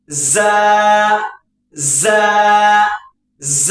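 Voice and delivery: ز hissed through closed front teeth; whistled za. Shown as a demonstration. Teacher models a hissing ز through the teeth. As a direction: leave a gap for the whistle. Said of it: "تو یہ نکل جائے گا اس طریقے سے".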